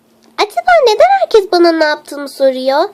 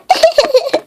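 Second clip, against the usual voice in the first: high, quick giggle; 6 semitones higher than usual. Acted. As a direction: let out a string of high giggles. Giggles about cake on head.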